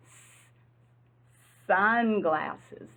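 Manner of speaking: a long hissing "S-" before "sunglasses"; teacher drawing out "S-" for kids; hissed S sound. Shown as a demonstration. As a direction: stretch the "S-" into a hiss, then say "sunglasses". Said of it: "Say that with me".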